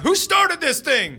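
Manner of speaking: high, slow, angry yell; mock outraged shout; freaking out. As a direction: demand it like a raging driver. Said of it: "some guy's freaking out".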